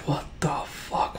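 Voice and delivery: stunned whisper, drawn out; hushed disbelief. Real, not acted. Game master stunned by a reveal, hushed disbelief. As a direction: whisper it slowly in shock.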